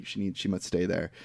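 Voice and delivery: low, soft, solemn refusal; hushed, deep, earnest film-hero voice; Little Bear. Retold or imitated. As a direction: imitate a grave character quietly forbidding it. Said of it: "Little Bear rejects this".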